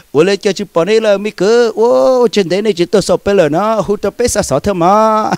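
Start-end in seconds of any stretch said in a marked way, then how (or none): none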